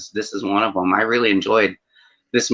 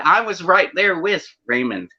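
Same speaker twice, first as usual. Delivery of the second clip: ordinary delivery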